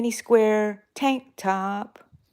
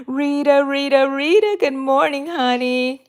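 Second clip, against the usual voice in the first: higher, lilting hello; sing-song greeting; 6 semitones higher, 3 dB louder than usual. Real, not acted. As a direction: call her name sweetly.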